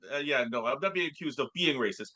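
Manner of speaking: normal talking voice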